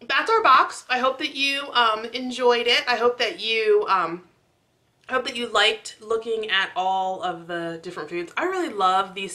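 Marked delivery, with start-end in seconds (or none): none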